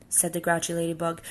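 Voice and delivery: ordinary delivery, nothing marked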